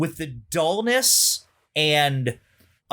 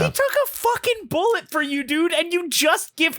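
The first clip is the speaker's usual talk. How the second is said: much higher, exasperated outburst; indignant; 11 semitones higher than usual. Real, not acted. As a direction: rant at the writer in disbelief. Indignant rant about the writer.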